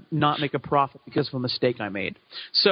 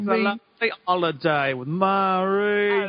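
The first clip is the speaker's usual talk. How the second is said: sing-song mock cockney; cockney accent. Shown as a demonstration.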